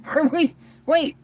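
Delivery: high, startled wait, are we, wait; confused double take. Retold or imitated. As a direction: catch yourself mid-thought in shock. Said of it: "I was like".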